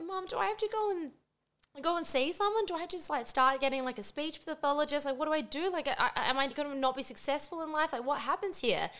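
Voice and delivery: high-pitched, anxious retold questions; upset. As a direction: re-enact worried pleading to mum, pitched high. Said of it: "I was so upset".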